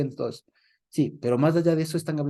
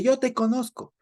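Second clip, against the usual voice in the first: demon's challenge; 9 semitones higher than usual. Retold or imitated. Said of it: "quiso sorprender y quiso dominarle".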